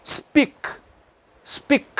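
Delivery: "Speak" ending on a released k, no vowel; bare final K. Shown as a demonstration. Teacher modelling the word, no I after "Speak". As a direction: stop "Speak" right on the k. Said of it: "Não tem I".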